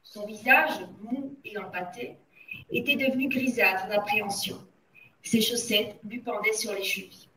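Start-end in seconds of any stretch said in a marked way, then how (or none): none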